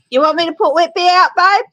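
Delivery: called loud and high to the next room; calling out. Real, not acted. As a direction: shout the question across the house.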